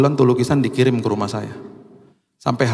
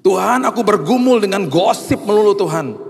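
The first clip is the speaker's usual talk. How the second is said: loud, high wail to God; acted anguished imploring, pitched well up; pleading prayer; 9 semitones higher, 5 dB louder than usual. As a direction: cry out like a struggling believer.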